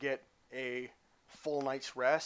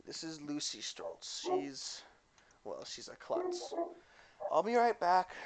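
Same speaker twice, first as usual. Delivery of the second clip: put-on German accent; 3 semitones higher, 3 dB quieter than usual. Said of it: "giving her a little German accent".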